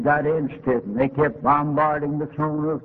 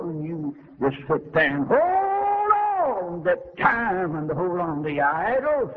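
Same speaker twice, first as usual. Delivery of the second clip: tearful rebuke; 5 semitones higher than usual. Retold or imitated. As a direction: let the voice break as you scold. Preacher rebuking the dying woman.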